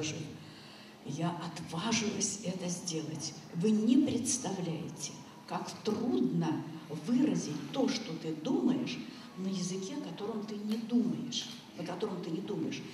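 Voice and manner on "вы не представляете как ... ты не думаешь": drawn-out, emphatic words; slow emphasis. Real, not acted. Speaker telling audience how hard expressing thoughts is, slow and emphatic. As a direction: stretch the key words slowly, with weight.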